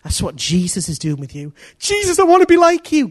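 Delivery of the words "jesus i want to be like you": high, loud eager plea; eager believer. Acted. Preacher voicing a believer asking Jesus, high eager plea. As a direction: cry out to Jesus like a keen believer.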